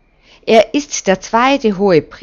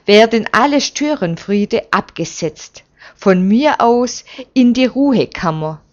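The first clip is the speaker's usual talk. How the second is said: firm, loud and higher threat; resolute threat; 3 semitones higher, 5 dB louder than usual. Acted. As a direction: lay down the law, forceful. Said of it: "So resolut hat man Zacharias nie erlebt".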